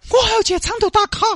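high, fast, pushy woman pleading to leave; high hurried woman's voice; impatient woman. Acted. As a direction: rush the nurse, you must go now. Li urging the nurse.

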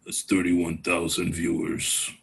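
deep, slow Undertaker promo voice; Undertaker wrestling promo. Pretend. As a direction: growl it low like the Undertaker. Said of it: "picture fucking Undertaker talking, like, doing a promo".

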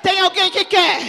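loud, rising sermon cry; fiery preaching. Real, not acted. Pastor warns the church, shouting in full preaching fervour. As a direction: preach it hard at the congregation.

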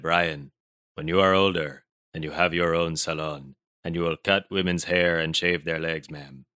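low, measured Barbadian accent; Barbadian teacher. Teacher interrupting a chatty student, low Barbadian accent impression. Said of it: "He said".

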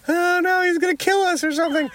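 high, fast hammed-up dread; mock terror. Band facing scary tour manager, hammed-up high dread. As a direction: squeal it like you're doomed.

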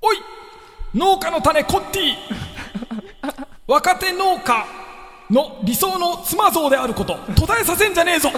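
high, loud raspy shout; hoarse voice. Shown as a demonstration. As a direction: yell it like a gruff old farmer. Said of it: "しゃがれた声で言います". (しゃがれた声で叫ぶ)

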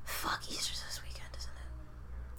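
whispered dismay. Real, not acted. Realizing Easter shift, whispered dismay. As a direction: breathe it out in dismay. Sudden realization about work.

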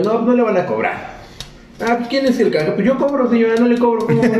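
high, mocking back-and-forth with a customer; mock exchange with customer. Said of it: "le digo".

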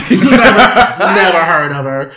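loud, slow, laughing mock denial; hammed-up imitation of a clueless co-host; Rob. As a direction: drag it out loudly through laughter. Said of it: "Rob will be like".

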